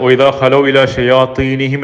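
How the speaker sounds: slow measured Arabic recitation; Quran recitation. Acted. Preacher intoning the prayer to the congregation, slow recitation. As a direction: intone the verse slowly.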